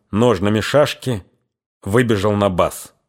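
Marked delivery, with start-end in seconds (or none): none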